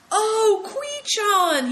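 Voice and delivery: very high, loud exclamation; sudden shout. Acted. Big Tiger making a discovery, shouting suddenly. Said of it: "he shouted suddenly".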